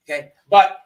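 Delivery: normal talking voice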